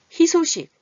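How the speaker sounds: 희소식 called out high and loud; high stressed example. Shown as a demonstration. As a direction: ring out 희소식 to the class. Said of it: "발음하기 어려워요".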